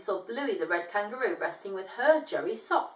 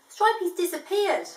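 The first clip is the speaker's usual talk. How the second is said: high, loud alarm; alarmed news; 9 semitones higher, 5 dB louder than usual. Acted. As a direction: blurt out the bad news. Announcing lost chick.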